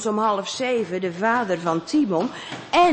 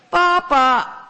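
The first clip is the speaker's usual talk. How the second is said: high, distant call; voice from upstairs; 7 semitones higher, 6 dB louder than usual. Acted. Unseen person calling from upstairs, high and distant. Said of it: "klinkt het dan ineens van boven".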